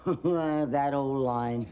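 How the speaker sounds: put-on low, slow unimpressed girl; dry girl voice. Grandma as the girl mocking the frog's pickup line, slow and dry. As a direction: say it slowly, unimpressed. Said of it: "And the girl said".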